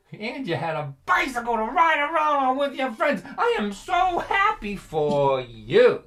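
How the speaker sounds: loud, high mock-joyful yell, stretched out at the end; sarcastic father's outburst. Father resenting his son's easy childhood, loud sarcastic outburst. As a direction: blow up into biting, exaggerated congratulation.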